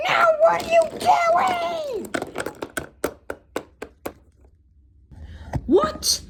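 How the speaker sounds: high, frantic shout; alarmed shriek. Acted. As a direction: cry out in alarm. Character cries out in alarm.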